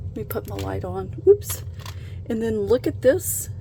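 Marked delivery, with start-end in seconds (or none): 1.3-1.6 sudden high, loud yelp of a slip; quick surprised squeak; startled oops. Real, not acted. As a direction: let it pop out as something slips. Fumbles turning light on.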